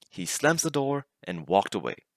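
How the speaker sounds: usual speaking voice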